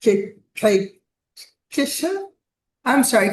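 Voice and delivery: ordinary delivery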